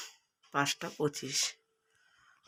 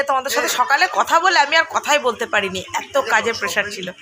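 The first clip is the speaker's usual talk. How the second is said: ordinary talk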